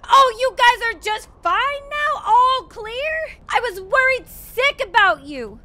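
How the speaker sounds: high, loud overjoyed gush; high, loud hammed-up relief at revived squad; mock relieved gushing.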